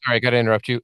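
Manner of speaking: ordinary delivery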